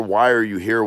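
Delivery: ordinary talk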